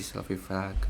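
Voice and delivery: "fraca" dito bem mais baixo e grave; fraco. Shown as a demonstration. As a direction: deixe a voz cair e sumir. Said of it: "a intensidade do som". (voz fraca e baixa)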